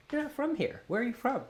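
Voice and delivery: high-pitched imitation of suspicious Americans; mock questioning. Americans quizzing a Canadian newcomer, high mock questioning. Mimicking Americans spotting his accent.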